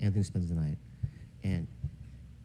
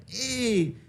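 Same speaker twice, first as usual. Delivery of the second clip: imitating dad's high happy greeting; happy high hey; 13 semitones higher than usual. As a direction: greet him high and bright. Dad greeting again.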